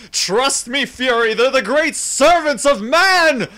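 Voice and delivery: theatrical over-the-top rapture; manic enthusiasm. Acted. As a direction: ham it up like a gleeful madman. Villain's hammy praise of dogs.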